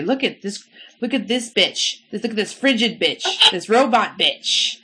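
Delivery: imitating a man jeering at a stranger; mock denouncing. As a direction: announce the insult to everyone, slowing at the end. Imitates man denouncing her to passengers.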